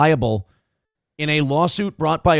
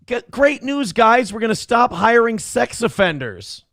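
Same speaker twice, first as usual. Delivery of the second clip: high, bright fake PR cheer; mock cheery announcement; 7 semitones higher than usual. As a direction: announce it like a proud spokesman.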